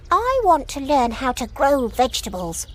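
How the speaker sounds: high, eager little rabbit voice; keen young rabbit lifted high. Acted. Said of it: "eagerly replied Harvey".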